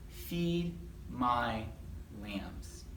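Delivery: quiet, falling memory verse; soft and low. Acted. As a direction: let it sink to a hush.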